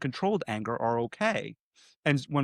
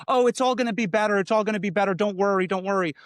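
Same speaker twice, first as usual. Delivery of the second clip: higher, lightly sing-song reassurance; mock consoling tone; 6 semitones higher, 3 dB louder, faster than usual. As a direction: pat them on the back with your voice. Consoling someone after a loss.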